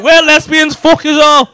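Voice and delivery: loud, brash proclamation; mock brash announcement. Comedian mocking a couple announcing they're lesbians, loud brash tone. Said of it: "It's like".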